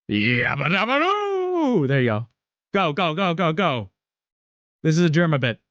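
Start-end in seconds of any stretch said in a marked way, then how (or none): 0.1-1.9 high, slow Flintstone yell; Fred Flintstone impression. Streamer answering chat's request, hammed-up Fred Flintstone shout. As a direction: stretch out the doo like Fred. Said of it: "Rapid fire impressions".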